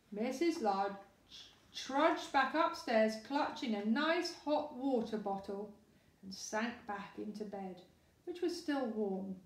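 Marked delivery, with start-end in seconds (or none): none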